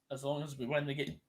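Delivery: soft words around a burp; muted, swallowing a burp; quiet, mid-burp. Real, not acted. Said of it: "Sorry, I burped there".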